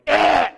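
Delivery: loud mock grunt of disgust; hammed-up loud yuck grunt. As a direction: bark out a loud grossed-out noise. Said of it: "you guys go like".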